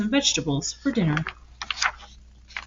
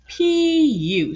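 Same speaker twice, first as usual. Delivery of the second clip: higher mock disgust; disgusted duck; 7 semitones higher than usual. Duck mocking the pigs' stink, higher. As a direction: hold your nose at the smell.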